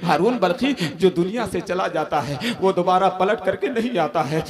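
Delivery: normal talking voice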